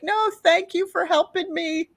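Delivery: high, gushing thanks; grateful to kids. Retold or imitated. Storyteller to the helpful kids, grateful.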